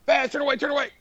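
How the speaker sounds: squeaky falsetto pretend panic; mock panic. Imagined crew echoing the order to the cameraman, mock panic. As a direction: echo the orders in a very high, frantic voice.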